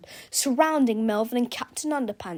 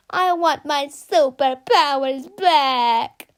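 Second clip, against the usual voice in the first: slow, loud bawling whine; whining; 4 semitones higher, 8 dB louder, much slower than usual. Acted. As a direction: blubber it like a brat. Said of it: "sobbed Melvin".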